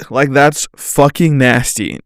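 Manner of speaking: ordinary talk, nothing marked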